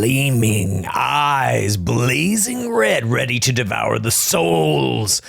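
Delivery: slow, stretched horror narrator; hammed-up menace, drawing out each word; mock ominous narration. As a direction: build dread like a campfire villain.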